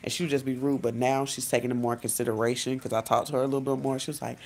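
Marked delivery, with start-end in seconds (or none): none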